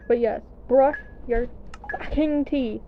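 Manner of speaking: usual speaking voice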